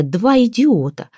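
high, drawn-out exclamation; understated roar of despair; exasperated roar. A narrator's reading. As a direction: wail it high and slow. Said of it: "взревела Ляля".

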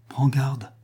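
une touche de peur; avertis-le, inquiète; effroi léger. A narrator's reading. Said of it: "fit Berthe avec effroi". (Berthe effrayée met Max en garde)

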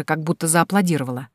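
usual speaking voice

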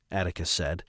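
ordinary delivery, nothing marked

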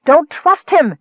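high, loud warning cry; urgent cry. A narrator's reading. Nicole in the mage's grasp warning Michael, crying out. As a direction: cry out a warning, pitch jumping up. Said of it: "Nicole cried".

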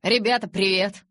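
loud, bright, happy hello; cheerful greeting. A narrator's reading. Jacob cheerfully greeting the tense room. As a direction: burst in glad to see everyone. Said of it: "радостно поздоровался он".

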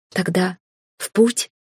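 uplifted. A narrator's reading. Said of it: "кивнула я с душевным подъёмом".

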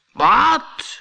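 a giant jolted awake, shouting; loud, high startled yell. A narrator's reading. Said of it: "schreeuwde hij".

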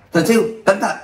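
high urgent yell; warning shout. Acted. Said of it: "Tiếng la hét vang lên". (tiếng hét cảnh báo)

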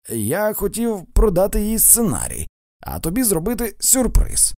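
high-pitched, earnest self-defence; acted pleading, pitched well up; pleading explanation. A narrator's reading. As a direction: plead your case, voice lifted high. Pleading explanation to his wife.

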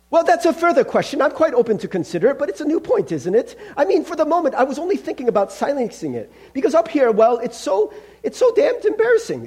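high-pitched, nervous stalling; flustered, evasive ghost. Acted. Ghost embarrassed about the lizard, flustered and evasive.

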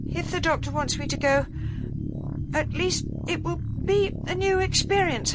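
put-on high, halting young woman's voice; nervous. A narrator's reading. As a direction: put on a brave front with nervous hesitations. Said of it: "She swallowed nervously".

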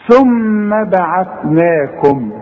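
ثم slow with the doubled م hummed long; ghunna on meem. Shown as a demonstration. Teacher modelling ghunna on the doubled meem. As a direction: hum the م of ثم before بعثناكم. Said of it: "الميم غنها".

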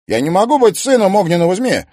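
fast, loud panicked denial; panicked protest. Acted. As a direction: rattle it out in panic.

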